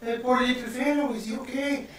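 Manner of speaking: high, sweet motherly voice; Lydia concerned. Acted. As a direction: coo over the sick boy. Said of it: "Lydia looks and she goes".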